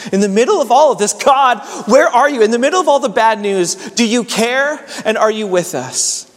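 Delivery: demanding answers from heaven; impassioned plea. Acted. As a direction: plead with God, pitch high and voice lifted. Voices anguished question to God.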